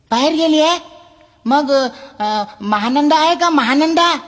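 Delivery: high, squeaky echo of बाहेर गेलीये with a questioning rise on गेलीये; a fussy reader who can't believe it; surprised customer. Acted. Customer repeats the librarian's words in high surprise.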